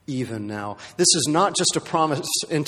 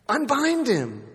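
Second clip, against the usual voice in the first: Unbind him called out much higher, ringing; commanding call; 11 semitones higher, slower than usual. Acted. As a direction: proclaim Unbind him to the crowd. Jesus commanding the crowd.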